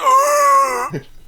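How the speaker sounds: loud high laughing squeal; cracking up mid-yell; laughing shriek. Retold or imitated. End of the grandparent bit.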